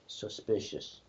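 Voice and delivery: muttered. Pretend. Quiet gag word.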